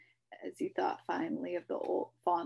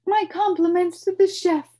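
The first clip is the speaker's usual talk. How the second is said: high, loud, falling flourish; pompous compliment; 12 semitones higher, 16 dB louder than usual. A narrator's reading. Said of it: "he announced to no one".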